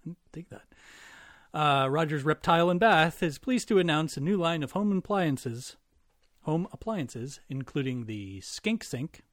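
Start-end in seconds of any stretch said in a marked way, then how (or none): 1.5-9.2 ad read. Acted. Reads sponsor ad.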